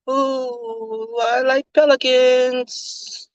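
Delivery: slow, airy nonsense to dodge the question; vacant, slow little voice; spacey dodge. Acted. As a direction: drift off dreamily as if you didn't hear. M U spacing out.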